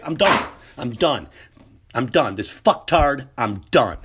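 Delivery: loud, fed-up sign-off. Pretend. Reporter walking away from the president, loud and fed up. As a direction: slam the door on it, loud.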